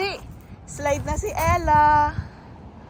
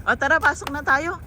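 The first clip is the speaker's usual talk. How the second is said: normal talking voice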